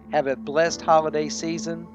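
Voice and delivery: ordinary delivery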